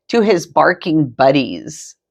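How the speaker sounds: usual speaking voice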